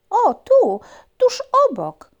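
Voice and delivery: very high, loud puppy. Acted. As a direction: point it out eagerly. Puppy answers.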